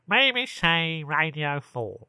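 slow, drawn-out put-on announcer; hammed-up male Radio four voice; mock male radio announcer. Shown as a demonstration. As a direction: stretch every word as a silly BBC man. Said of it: "They don't do that".

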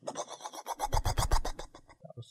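breathy rising then falling whoosh of a passing vehicle; trolley bus Doppler whoosh. Pretend. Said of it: "my funny impression of the Doppler effect".

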